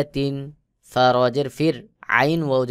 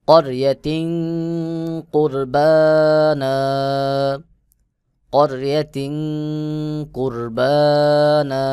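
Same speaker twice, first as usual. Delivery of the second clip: louder, higher, slow chanted recitation; correct recitation; 3 semitones higher, 5 dB louder, much slower than usual. Shown as a demonstration. Teacher gives the correct recitation to students, chanted slowly. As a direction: draw out কুরবানা with a long final alif. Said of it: "সহি উচ্চারণ হলো".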